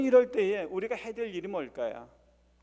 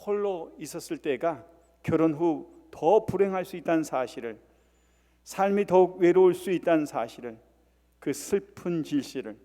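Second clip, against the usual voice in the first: ordinary talk, nothing marked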